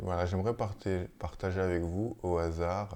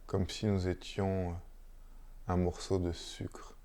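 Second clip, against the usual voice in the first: unhurried, hushed meditation guidance with long silences; guided meditation; 7 dB quieter than usual. Real, not acted. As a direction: lead listeners gently into stillness, phrase by phrase. Guide une méditation après la lecture. (méditation guidée, posée, entre de longs silences)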